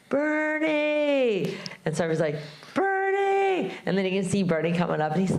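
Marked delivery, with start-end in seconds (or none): 0.1-1.6 high, drawn-out call of a name across a field. Retold or imitated. Showing the guys how to call. 2.7-3.7 calling out. Real, not acted. Calls out to approaching friend.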